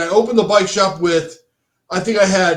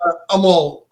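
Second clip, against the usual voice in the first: normal talking voice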